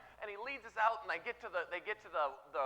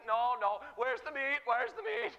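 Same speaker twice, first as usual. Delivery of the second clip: high, loud, demanding grumble; exaggerated crowd complaint; mock whining; 5 semitones higher, 6 dB louder than usual. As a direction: pester like a spoiled complainer, pitch climbing. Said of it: "whining, complaining".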